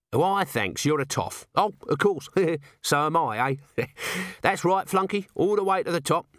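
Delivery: high, cheeky commoner with little chuckles; tipsy, chummy Nobby. Acted. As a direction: play along, pleased with yourself.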